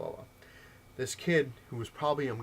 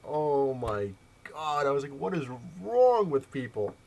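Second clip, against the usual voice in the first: slow, drawn-out, raised-voice dismay; exasperated disbelief; 4 semitones higher, 5 dB louder, slower than usual. Retold or imitated. Player reacts to the kid's abuse, exasperated. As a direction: stretch it out in appalled exasperation.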